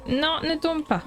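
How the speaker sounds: high, quick "non"; real alarmed plea; urgent plea. Real, not acted. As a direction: beg it not to fall. Item about to fall.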